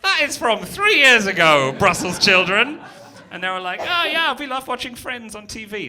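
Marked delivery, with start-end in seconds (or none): 0.0-2.8 mock scolding. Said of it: "I was like". 3.8-5.9 a high, lightly voiced Belgian kid accent; kids' accent. Comedian imitates Brussels children's accent, lightly. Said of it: "That's not how they sound, but now I just have the accent in my head".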